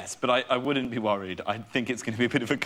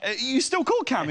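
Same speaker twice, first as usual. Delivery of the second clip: retold incredulous question; 12 semitones higher than usual. Retold question to old schoolmate.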